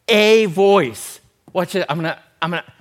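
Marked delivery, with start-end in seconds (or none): 0.1-1.2 "a" said as a long, loud AY; stress on A. Shown as a demonstration. Preacher showing the congregation John's wording, stress on A. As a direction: hit "a", pronounced AY, then voice.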